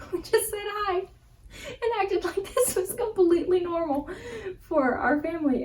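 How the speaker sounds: high, airy, overly casual; mock breezy. Family greets the honeymooners as if normal, high breezy. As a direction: pretend nothing happened, lilting up high.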